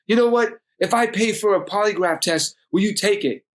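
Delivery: heated high-pitched challenge; angry. Retold or imitated. Angry dad challenges son to polygraph test, angry. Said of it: "And he's angry about it".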